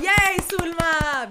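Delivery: slow loud high cheer; drawn-out happy yay; cheering her on, loud and high. Real, not acted. Cheering for cousin.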